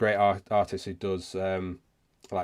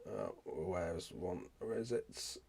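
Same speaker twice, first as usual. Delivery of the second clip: very soft, slow self-talk while searching; hushed, distracted mumble; muttering to oneself; 15 dB quieter, slower than usual. Real, not acted. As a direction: murmur it absently, hunting for something. Searching the screen, talking to himself.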